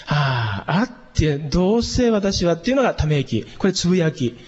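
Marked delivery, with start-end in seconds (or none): 0.0-0.8 weary resigned "haa" as if giving up; defeated sigh. Shown as a demonstration. Teacher showing the wrong sigh before God, resigned. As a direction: sigh like saying "what's the use". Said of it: "ため息みたいになっちゃう".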